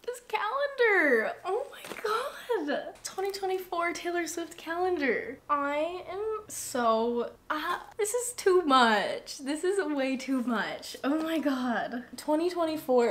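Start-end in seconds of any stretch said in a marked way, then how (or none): 0.0-9.4 high-pitched, overwhelmed gasp of joy; amazed delight. Real, not acted. Unexpected gift pulled from package.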